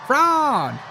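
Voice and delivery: put-on high, loud parody of a Southern accent; mock-preaching Southern shout; mocking fake Southern accent. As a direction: belt it out in an overdone Southern accent. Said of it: "I wanna hear this again".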